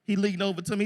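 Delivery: ordinary talk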